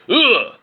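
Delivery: high, loud disgusted groan; disgusted ugh. A narrator's reading. Innkeeper seeing the goblin, loud high ugh. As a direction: recoil with an ugh. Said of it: "said the innkeeper".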